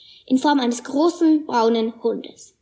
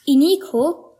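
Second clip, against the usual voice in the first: usual speaking voice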